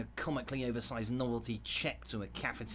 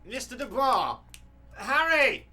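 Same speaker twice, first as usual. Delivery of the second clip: from firm call to full shout; calling out, building to a shout; 8 semitones higher, 10 dB louder than usual. Acted. Union boss trying to snap Harry out of a stupor, rising shout. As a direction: call his name louder each time until you yell.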